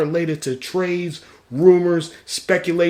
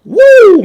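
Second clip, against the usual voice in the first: loud, high whoop of joy; celebration whoop; 15 dB louder than usual. Real, not acted. Host celebrating the Super Bowl result, loud high whoop. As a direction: let out a big happy woo.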